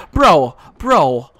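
loud exclamations, punched out; fed-up, forceful outburst; exasperated. Real, not acted. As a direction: protest loudly in disbelief. Outraged after missing a kill.

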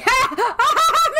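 a burst of loud high laughter; real laughter at the joke. Real, not acted. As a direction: crack up out loud. Laughing at the Spanish ad.

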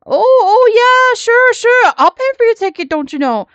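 high, loud mock Canadian accent, easing down at the end; put-on Canadian accent. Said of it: "my best Canadian accent".

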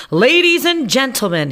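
high squeaky showman call to the crowd; a mouse doctor squeaks grandly to ladies and gentlemen; squeaky announcer. Acted. Doctor Varmint presents his find to a crowd, squeaky and grand. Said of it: "he squeaked".

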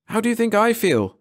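high, breathless gasp. A narrator's reading. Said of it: "Mara gasped".

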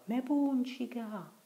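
very high, slow far-off cry; a cry that barely carries back; distant shout. Acted. Riccetto shouting back from far in the river, distant cry. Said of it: "si udì appena la sua voce che gridava".

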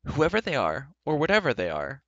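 loud determined cry; rally the group boldly. Acted. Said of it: "cried Terran".

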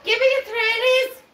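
high, loud fake Scouse; bad Liverpool accent. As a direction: shout it in a badly faked Liverpool accent. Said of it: "That don't sound like Liverpool".

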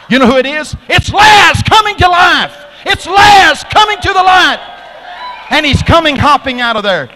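high, shouted play-by-play frenzy; wildly excited commentator at full volume. Retold or imitated. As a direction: scream the miracle like a winning goal. Acted commentator announces Lazarus alive.

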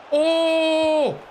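high held startled oh; surprised shout. Real, not acted. Streamer reacting to a sudden play, surprised. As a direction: caught off guard, cry out.

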